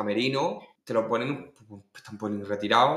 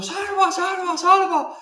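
very high, loud imitated chant; shrieked crowd impression, repeated; crowd calling out; 5 dB louder, much slower than usual. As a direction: call the name like an excited crowd. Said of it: "todo el mundo".

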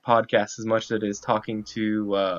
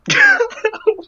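high burst of laughter; shrieking laugh; 8 dB louder than usual. Real, not acted. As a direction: let out a squealing laugh. Reacting to absurd quiz title.

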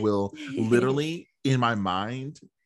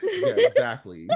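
laughing; 3 dB louder than usual. Real, not acted. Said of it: "laughing at pretzel joke".

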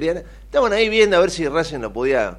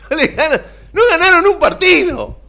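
high, loud cry of laughing disbelief; incredulous shout; 11 dB louder than usual. Real, not acted. Pundit protesting that Boca reached the final winless, incredulous shout. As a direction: burst out yelling through laughter.

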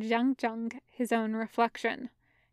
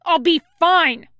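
high, loud yell; shouted insistence; 6 semitones higher, 9 dB louder than usual. A narrator's reading. Pinned fighter insists he will manage, shouting. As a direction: yell it to send them away.

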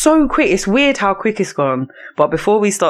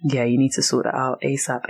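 ordinary delivery

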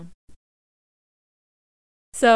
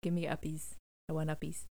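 put-on soft babyish plea, much softer; Grogu whining for uppies; 3 semitones lower, 12 dB quieter than usual. Fan acting out Grogu begging Luke to pick him up, soft baby voice. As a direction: coo it like a toddler reaching up to be carried. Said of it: "He's like, he's like".

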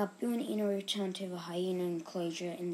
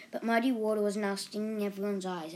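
ordinary delivery

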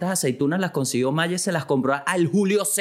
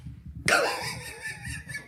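high squealing laugh; 8 dB quieter than usual. Real, not acted. Long laugh after joke.

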